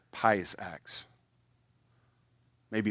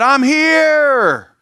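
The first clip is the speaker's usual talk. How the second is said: much higher, louder announcing call; trumpet fanfare boast; 14 dB louder than usual. Retold or imitated. Mock trumpeter announcing himself to church, loud and high. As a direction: blare it like a herald. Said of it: "blew on this rascal. Said".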